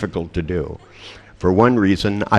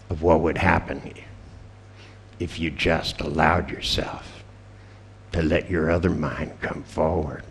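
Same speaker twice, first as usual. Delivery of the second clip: hypnotic induction; 3 semitones lower, 6 dB quieter than usual. Shown as a demonstration. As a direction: lull her down gently.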